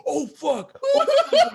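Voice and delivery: high, loud laughing panic. Retold or imitated. Recalling his shock at the slap, high laughing panic.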